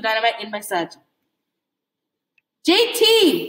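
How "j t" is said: a touch of a sharp barked call. A narrator's reading. Red barking at his brother J T, mild. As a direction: call him out, mildly gruff. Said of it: "he barked".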